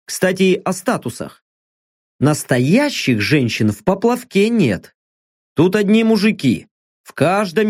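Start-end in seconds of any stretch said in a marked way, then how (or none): none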